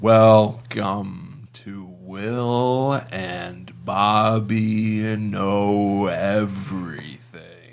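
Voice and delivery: extremely slow, dragged-out words; really slow. Shown as a demonstration. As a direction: stretch every syllable out. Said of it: "Wait, let's talk really slow".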